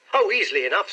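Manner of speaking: bright, cheerful reply; breezy and upbeat; cheerily. A narrator's reading. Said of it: "said Hall Pycroft cheerily".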